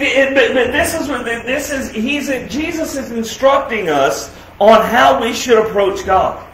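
ordinary talk

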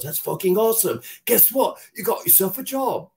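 high, excited director voice; thrilled. Retold or imitated. Director hiring him after the Freddy laugh, high and excited. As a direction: burst with delight like a director who found his guy.

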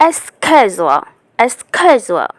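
Escazua with a hard sk sound, American accent; American accent demo of escazua; American pronunciation. Shown as a demonstration. Teacher models American escazua for students. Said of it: "American ল্যাঙ্গুয়েজে কী হবে?".

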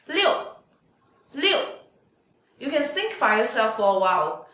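ordinary delivery

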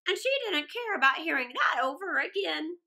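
usual speaking voice

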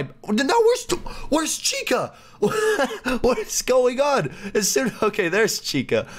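squeaky high alarmed question; high startled squeak on what is going on; surprised. Real, not acted. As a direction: voice jumps up in sudden alarm. Animatronics moved, panic.

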